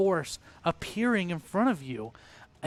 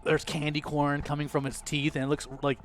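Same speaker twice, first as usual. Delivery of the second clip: ordinary delivery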